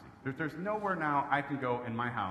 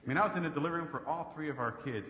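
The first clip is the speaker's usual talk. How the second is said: normal talking voice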